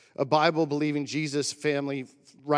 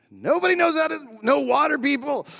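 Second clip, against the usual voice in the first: loud, much higher playful jab at the crowd; teasing call-out; 12 semitones higher, 7 dB louder than usual. Real, not acted. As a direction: tease them loudly from the pulpit. Teasing the crowd about swimming.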